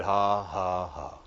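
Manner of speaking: slow, spaced-out mock laugh; deliberate laugh. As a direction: imitate a forced "ha, ha, ha". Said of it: "he just began to laugh".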